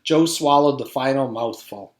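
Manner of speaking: usual speaking voice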